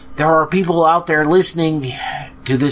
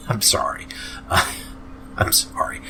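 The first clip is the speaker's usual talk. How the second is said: soft laughing apology; 7 semitones lower, 8 dB quieter than usual. Real, not acted. Laughing apology after a long rant.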